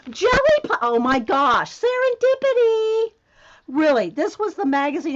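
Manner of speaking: high, loud squeal of delight; thrilled, voice leaping up loud; amazed excitement. Real, not acted. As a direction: burst out at a lucky find. Amazed by a lucky magazine find.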